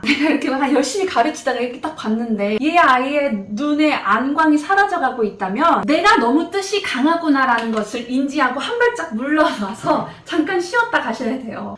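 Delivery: usual speaking voice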